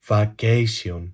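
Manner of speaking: put-on VACATION low and soft in a Greek accent; mock Greek accent. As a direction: pronounce "Vacation" with Greek vowels and s. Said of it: "με ελληνική προφορά".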